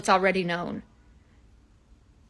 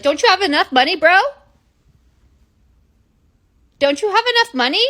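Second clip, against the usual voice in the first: louder and higher, exasperated; indignant outburst; 9 semitones higher, 6 dB louder than usual. Real, not acted. Crystal ranting at money-hungry gurus, exasperated. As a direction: call them out, fed up.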